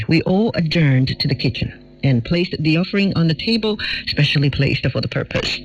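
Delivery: plain reading voice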